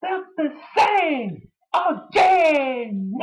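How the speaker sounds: hammed-up lament; dramatic drawn-out. Real, not acted. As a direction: stretch it out dramatically. Dramatic ending of sentence.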